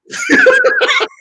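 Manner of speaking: sudden shrill cackle; loud high cackle. Acted. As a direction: burst into a high-pitched laugh. Caller laughs mid-complaint.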